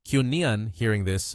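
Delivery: usual speaking voice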